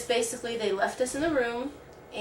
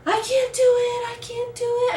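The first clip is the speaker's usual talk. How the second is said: a retold labor cry, pitched way up and loud; desperate loud cry; 8 dB louder than usual. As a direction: wail it out like you are at your limit in labor. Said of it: "I was just screaming".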